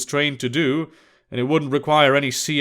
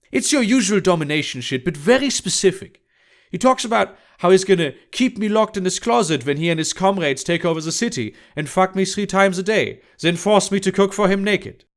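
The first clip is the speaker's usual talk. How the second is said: higher-pitched, frank woman's character voice; blunt Emma; 4 semitones higher than usual. Acted. Emma reports her customer's talk to Thaddeus, high and frank. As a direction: relate it matter-of-factly in a raised character pitch.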